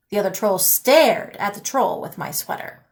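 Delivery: plain reading voice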